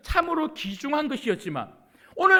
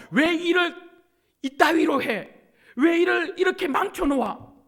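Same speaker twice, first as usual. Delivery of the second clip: loud, high-pitched mock rebuke from God; acted-out furious telling-off; angry scolding; 6 semitones higher, 7 dB louder than usual. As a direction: tear into a failed servant, voice raised. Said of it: "화내신 게 아니에요".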